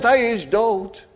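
emphatic retold announcement; crowd's declaration. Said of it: "En de menigte al zegt".

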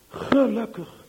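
ordinary delivery

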